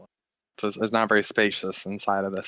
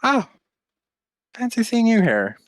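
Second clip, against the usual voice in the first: coy surprised greeting; 11 semitones higher, 5 dB louder than usual. Acted. GM voices half-elf Aurelia.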